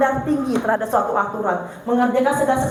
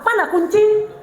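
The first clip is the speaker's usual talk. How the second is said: higher, louder sharp "where's the key"; angry demand; 7 semitones higher, 3 dB louder, slower than usual. Retold or imitated. Wife imitating husband angrily asking for his keys, sharp and high. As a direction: snap it like an irritated husband. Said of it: "bergeser dia marah".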